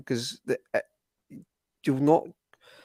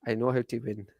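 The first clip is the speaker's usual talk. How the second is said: softer, amateur Irish accent impression; Rodgers's Irish accent; 6 dB quieter than usual. Shown as a demonstration. Pundit redoing the manager impression for co-host, rough Irish accent. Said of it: "Do it again".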